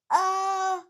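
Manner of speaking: loud high gasp before sneezing; pre-sneeze gasp. Acted. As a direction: suck in air before a sneeze. Gerald about to sneeze.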